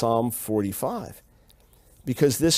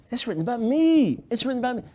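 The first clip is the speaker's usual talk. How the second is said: higher, eager voice of a man who just saw it; excited revelation; 9 semitones higher, faster than usual. Acted. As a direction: declare it as a sudden discovery. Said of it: "The psalmist gets the revelation, oh".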